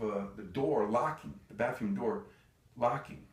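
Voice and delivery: ordinary delivery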